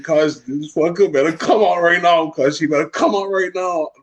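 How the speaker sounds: high-pitched, hammed-up tearful rant; mock crying rant. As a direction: imitate your own crying livestream meltdown. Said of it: "crying on live".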